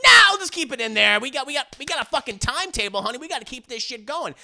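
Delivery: high, loud burst of mock refusal, then rushing; mock impatient filmmaker. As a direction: imitate a harried director overruling the crew. Acted filmmaker refusing a reshoot.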